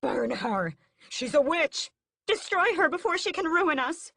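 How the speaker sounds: high, loud furious yelling; angry mob screams. A narrator's reading. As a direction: scream for her to burn. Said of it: "Angry screams come from the humans".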